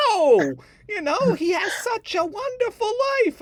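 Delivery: very high, loud insistent ditzy squeak; hammed-up stubborn sister; clueless Jill. Sister insists she is right, squeaky loud protest. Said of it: "She's like".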